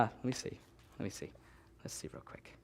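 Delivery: whispered to himself; breathy hushed aside. Real, not acted. Whisper near baby.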